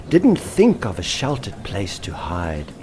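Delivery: ordinary delivery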